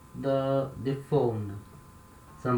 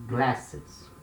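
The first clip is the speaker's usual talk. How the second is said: ordinary talk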